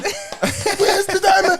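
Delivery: slow, high, drawn-out laughing cry; slowed imitation of the movie line; slow mock movie cry. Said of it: "repeating movie line".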